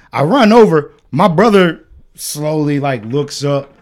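normal talking voice

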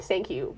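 "thank you" begun with an S, as "sank you". Shown as a demonstration. Dad giving customers his name, "thank you" with an S. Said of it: "with an S, so like".

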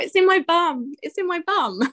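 high-pitched retold cry of surprise; mid-labour yell, laughing at herself; shouting out. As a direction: blurt it out high and urgent. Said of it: "I remember shouting out".

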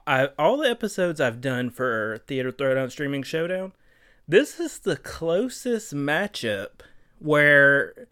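normal talking voice

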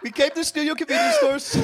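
very high mock Indian accent with laughter. Aliens announcing their raid on stores, in an Indian accent. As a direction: squeal it playfully in an Indian accent. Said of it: "they just all have Indian accents".

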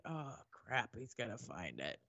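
low, hushed inner groan; sinking dread. Retold or imitated. Smuggler thinking as the guard searches, sinking dread. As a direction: let the voice drop as hope goes.

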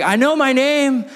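mock God getting tired of his name; exasperated God voice. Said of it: "and God's just like".

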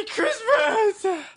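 high, loud fake crying wail; mock sobbing. Friend pretends to meet [name], hammed-up sobbing. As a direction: wail the names like a starstruck fan in tears. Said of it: "Like, I'd be like".

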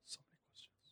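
breathy whispered mimic; whispered echo.

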